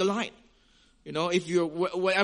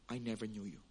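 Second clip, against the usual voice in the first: much softer, low, stern delivery; stern and simple; 9 semitones lower, 15 dB quieter, faster than usual. Acted. A preacher voices Jesus's verdict, stern, low and soft. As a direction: say it plainly and quietly, like a final word. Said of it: "stern, simple, and powerful".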